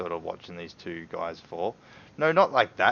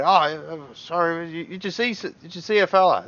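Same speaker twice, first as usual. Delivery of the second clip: apologetic guard; 10 semitones higher, 7 dB louder than usual. Acted.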